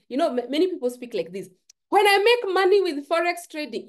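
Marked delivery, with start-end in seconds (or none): 1.9-3.9 high, loud mock of a hopeful beginner; mocking wrong way. Coach mocks beginners who say when I make money. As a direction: parody the wishful talk you're warning against, pitched high. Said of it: "don't speak like this".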